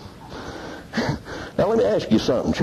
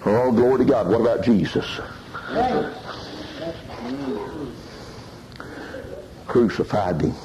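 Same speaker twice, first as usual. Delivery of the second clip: high, shouted, laughing whoop; preaching at full force; 5 semitones higher than usual. Acted. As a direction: lift the church with joyful hollering. Preacher climaxes, hollering.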